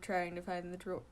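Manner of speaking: usual speaking voice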